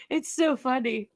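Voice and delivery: ordinary delivery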